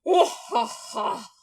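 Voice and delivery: bear's mock laugh. Bear laughing at the puny squirrel. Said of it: "then he laughed".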